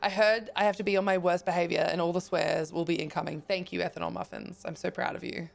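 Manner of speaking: ordinary delivery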